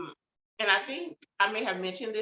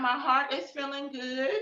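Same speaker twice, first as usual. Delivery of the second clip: ordinary talk, nothing marked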